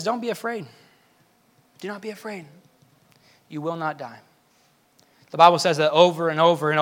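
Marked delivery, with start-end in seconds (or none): 0.0-4.2 much softer, gentle reassurance; almost whisper the comfort; soft voice of God comforting the frightened; hushed reassurance. Acted.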